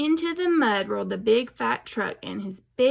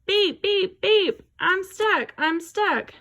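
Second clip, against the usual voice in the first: high, loud urgent truck beeps; urgent beeping; 9 semitones higher, 4 dB louder, slower than usual. A narrator's reading. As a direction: honk for help like a little truck. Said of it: "Everybody heard that".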